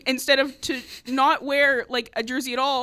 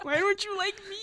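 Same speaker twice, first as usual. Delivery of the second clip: higher-pitched mock-wounded plea; mock-hurt; 7 semitones higher, 5 dB quieter than usual. Teasing the host for ignoring them, mock-hurt. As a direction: playfully demand some affection.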